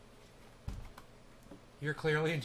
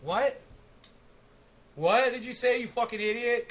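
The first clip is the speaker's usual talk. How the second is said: higher, louder furious yell; angry shout; 6 semitones higher, 6 dB louder than usual. Acted. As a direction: bark it at her in disbelief. Yelling at a mumbling streamer.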